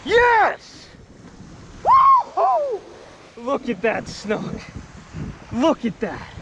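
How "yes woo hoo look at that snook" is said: ordinary talk, nothing marked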